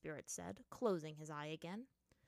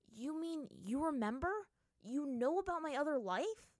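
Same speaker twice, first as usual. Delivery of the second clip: raised, loud, astonished questioning; heavy surprise; 7 semitones higher, 8 dB louder than usual. A narrator's reading. Aang learns the monkey remembers him, surprised. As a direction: pitch it high in amazed disbelief. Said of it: "surprise heavy in his voice".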